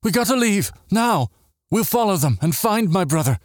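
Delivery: high, urgent plea to leave; earnest exclamation. A narrator's reading. Sister urging a rescue of her brother, earnest and urgent. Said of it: "nodded earnestly and exclaimed".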